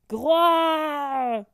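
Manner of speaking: loud roar of pain, voiced by the reader; dragon roar. Narrator voicing the hurt dragon, mock roar.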